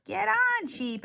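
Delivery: high, loud call; shout. Acted. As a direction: shout to the sheep. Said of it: "everyone shouted".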